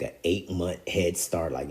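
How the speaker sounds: ordinary talk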